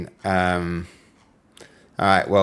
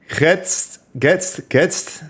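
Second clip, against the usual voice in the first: mock German; 6 semitones higher, much slower than usual. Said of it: "triggered my inner German".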